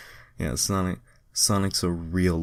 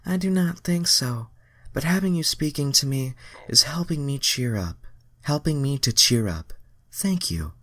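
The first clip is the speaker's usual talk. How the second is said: put-on high girlish voice; Starfire voice; 9 semitones higher than usual. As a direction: thank him gently as the heroine. Starfire voiced in fanfic reading.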